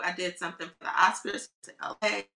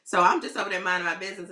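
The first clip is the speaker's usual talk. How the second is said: normal talking voice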